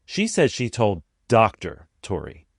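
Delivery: DOCTOR set apart in audible air quotes; pause, then a smirky, doubting "Doctor"; stress on DOCTOR. Shown as a demonstration. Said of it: "air quotes, or smirky face".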